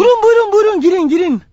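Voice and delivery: high, loud, bursting invitation; overeager welcome. Acted. Dick waving the would-be tenant inside, overeager and high. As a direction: gush the welcome in a rush of relief.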